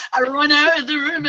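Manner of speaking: high and loud, rushing; a narrator caught up in the fright; panicked retelling. Retold or imitated. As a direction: relive the panic.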